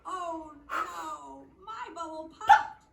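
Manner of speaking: put-on loud playful oh-no for kids; mock dismay. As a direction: act shocked for the children. Teacher mock dismay at bubble.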